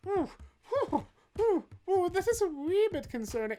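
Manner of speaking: high, hammed-up British accent slipping toward Irish; mock the Queen with a clumsy British accent that drifts into a leprechaun; Queen Elizabeth in a bad British accent. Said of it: "I can't do accents".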